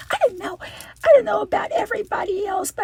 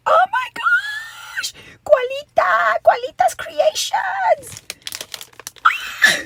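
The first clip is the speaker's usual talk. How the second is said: excited squeal; 10 semitones higher, 6 dB louder, slower than usual. Real, not acted. Unboxing a surprise gift.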